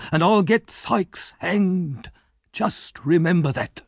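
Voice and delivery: high, loud raging threat, slowing at the end; lost his temper. Acted.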